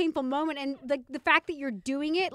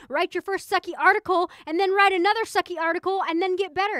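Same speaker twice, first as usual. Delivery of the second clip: loud, raised, emphatic pep talk; tough-love scolding; 5 semitones higher, 8 dB louder than usual. Retold or imitated. Roommate pushing a crying would-be writer, acted tough love. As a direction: lay it on hard like a blunt friend.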